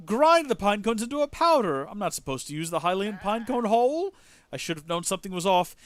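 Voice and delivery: lightly acted dismay; a touch of guilt in an animated character voice; remorseful goat owner. Goat owner apologising to his goats, mildly distraught.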